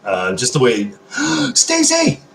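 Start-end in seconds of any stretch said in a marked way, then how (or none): 1.0-1.5 a sudden high delighted gasp; sharp excited gasp. Real, not acted. Gasps on seeing Stacy join the stream. 1.6-2.2 high, loud delighted shout of the name; excited call. Real, not acted. Excitedly calls out to Stacy arriving in chat.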